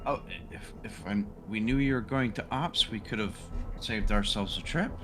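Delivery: usual speaking voice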